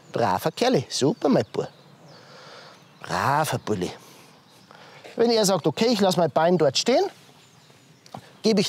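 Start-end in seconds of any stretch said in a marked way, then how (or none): 0.1-1.7 tender praise. Real, not acted. Praising the horse. 3.0-3.9 very low, soft murmured praise; deep soft pet talk to the pony; tender praise. Real, not acted. Praising the horse.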